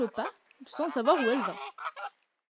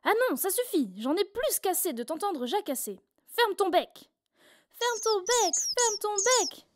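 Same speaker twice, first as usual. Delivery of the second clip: angry shout; 8 semitones higher than usual. A narrator's reading. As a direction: yell at the chatty chick to shut up. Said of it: "mère poule se met à crier".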